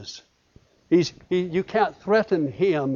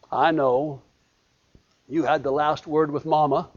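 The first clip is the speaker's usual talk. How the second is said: ordinary talk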